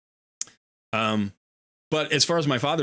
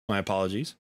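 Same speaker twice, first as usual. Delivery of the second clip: usual speaking voice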